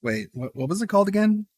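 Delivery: surprised. Real, not acted. Interrupting clip in disbelief.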